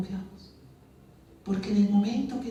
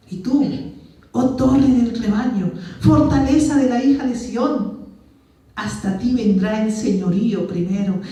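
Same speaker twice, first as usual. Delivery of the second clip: loud, solemn Bible reading, each phrase weighed; declaimed scripture reading; 5 dB louder than usual. Acted. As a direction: proclaim the prophecy to the congregation. Reads Micah aloud, declaiming.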